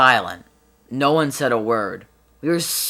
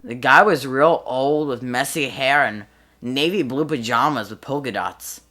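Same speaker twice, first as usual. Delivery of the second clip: ordinary talk, nothing marked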